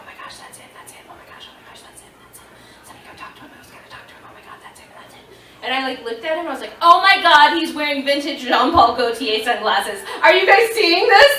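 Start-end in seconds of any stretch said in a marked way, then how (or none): 0.0-5.2 very soft whispered gushing; hushed starstruck whispering; excited whisper. Retold or imitated. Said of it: "voice actors were like". 6.8-11.3 loud excited gush; much louder fangirl exclamation; really loud. Retold or imitated. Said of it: "I said it, like, really loud".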